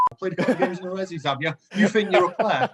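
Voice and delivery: lightly hammed-up senior player's dressing-down; mock telling-off. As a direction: imitate a player tearing into a youngster. Team-mate dressing down the youngster, retold.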